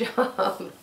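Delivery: ordinary delivery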